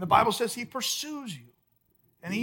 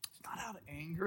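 whisper; 5 semitones lower, 16 dB quieter, much faster than usual. Real, not acted. Preacher drops to whisper.

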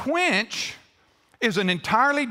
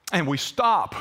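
normal talking voice

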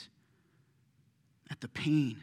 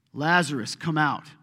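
much louder, calling the dead man out; 10 dB louder than usual. A narrator's reading. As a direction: command it across the tomb. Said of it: "he shouted with a loud voice".

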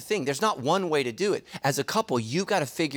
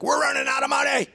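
high, loud mock panic; mock panicked wife; 10 semitones higher, 4 dB louder than usual. Husband imitating his wife's money panic, high and loud. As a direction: play the worried wife, pitched up and loud.

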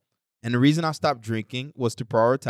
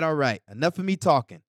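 ordinary talk

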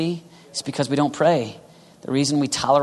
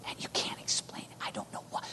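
whispered, helpless confession; very soft, voiceless and earnest; hushed wonder; 16 dB quieter than usual. Acted. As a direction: confide it in a bare whisper. Acts out inexplicable inner burden.

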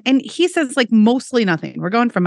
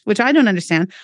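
ordinary talk, nothing marked

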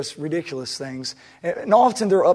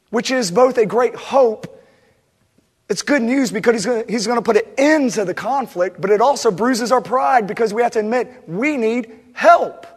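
louder, higher impassioned sermon voice; preaching at full force; 8 semitones higher, 9 dB louder than usual. Acted. Pastor preaching hard to the congregation about pride, impassioned. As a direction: drive it home from the pulpit.

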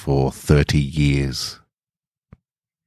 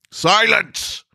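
furious shriek. A narrator's reading. Courtier silencing the stranger, furious shriek. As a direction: explode in a high shrieking fury. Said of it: "face turned an angry red".

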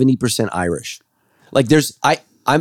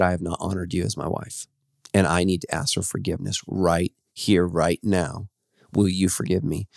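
much softer, humble plea; earnest apology; 4 semitones lower, 7 dB quieter than usual. Retold or imitated. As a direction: quietly beg forgiveness, sincere. Retold apology to wife on knees.

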